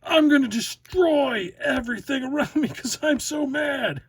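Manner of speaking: high, loud, put-on voice of a ranting fan; mock rage from an imagined fan; mock angry fan. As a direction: ham up a furious fan about to smash things. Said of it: "people are just like".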